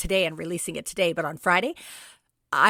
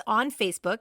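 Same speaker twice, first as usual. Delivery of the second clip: normal talking voice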